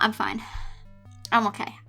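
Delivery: ordinary talk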